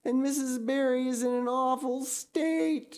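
high, tearful lament; wailing. Acted. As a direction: wail out the bad news in despair. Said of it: "she wailed".

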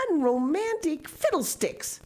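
unsympathetic scoff. A narrator's reading. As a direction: brush it off as nonsense. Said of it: "said the unsympathetic Marilla".

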